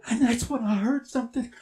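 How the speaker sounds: ordinary delivery, nothing marked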